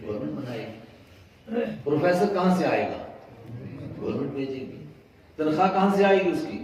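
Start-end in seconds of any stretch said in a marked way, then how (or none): 1.8-6.6 fast, loud and high, firing each question; rapid loud rhetorical questions. Acted. Preacher firing questions at the congregation, fast and loud. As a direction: hammer the congregation with quick questions.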